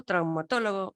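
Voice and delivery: ordinary talk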